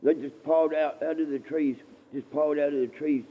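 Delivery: usual speaking voice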